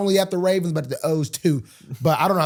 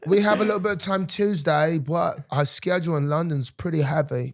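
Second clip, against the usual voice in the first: playful put-on London accent. Guest explaining the schedule, mock London accent. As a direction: carry on the fake London accent. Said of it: "Is that a London accent".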